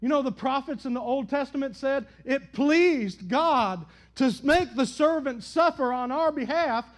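loud, high, rising preacher's cadence; impassioned pulpit preaching; full-force preaching. Acted. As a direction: build the sermon to a shout. Preaching builds again.

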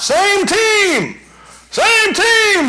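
high, loud coach's yell across the field; yelling to kids. Retold or imitated. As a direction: shout it out to five-year-olds on the pitch. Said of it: "You keep yelling out".

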